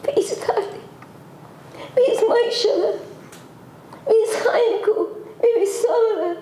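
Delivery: high, pleading calls with a break into crying; grieving lament calling for lost family; anguished calling out. Acted. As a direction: cry out each name as if searching for them.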